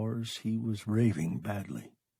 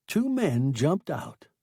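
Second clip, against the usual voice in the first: plain reading voice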